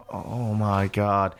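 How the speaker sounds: low, slow, hushed mock dismay; appalled onlooker. Onlookers watching a drunk yell at a clerk, appalled onlooker. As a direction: draw it out softly, imitating stunned bystanders. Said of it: "everyone was looking at you like".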